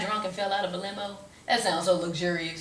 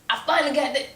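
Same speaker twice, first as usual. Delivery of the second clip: ordinary talk, nothing marked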